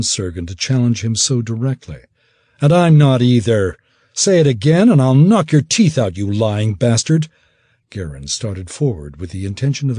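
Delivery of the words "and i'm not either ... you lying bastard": angry threat. Acted. Garin threatens cousin.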